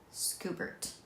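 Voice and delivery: soft whispered answer. Real, not acted.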